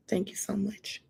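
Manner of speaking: a soft breathy whisper, barely voiced; whispered thanks. Real, not acted. Thanking her live viewers for their support, in a whisper. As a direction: whisper it, too moved to speak aloud.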